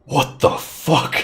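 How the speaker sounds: slow, whispery disbelief; incredulous captain. Acted. Captain looking at the wounded fighter, whispered disbelief. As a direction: breathe it out slowly, appalled. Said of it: "he says".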